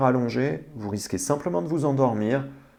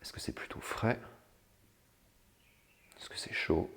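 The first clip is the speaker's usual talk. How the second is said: soft unvoiced meditation whisper; 4 semitones lower, 12 dB quieter than usual. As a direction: breathe the questions out softly. (chuchoté doucement)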